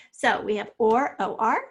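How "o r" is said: ordinary talk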